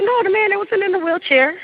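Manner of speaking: high-pitched, insistent clarifying; retold insistence. Storyteller retells correcting the sister, high and insistent. Acts out her confused clarification.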